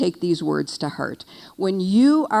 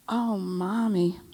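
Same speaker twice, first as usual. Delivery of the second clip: slow, soft imitation of a grown daughter's gentle voice; gently indulge your mother, lightly imitated; a touch of tender daughter calling her mother; sweet adult-child voice; 4 dB quieter, slower than usual. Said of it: "[name] said in this voice".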